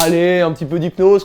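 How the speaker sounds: hammed-up cheer to down a shot; party shot cheer. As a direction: urge him on like a party host. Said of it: "comme si c'était un shot en soirée".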